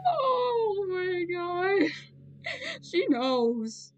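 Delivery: loud, slow, shocked disbelief. Real, not acted. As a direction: gasp it out in amazement. Character notices the recording.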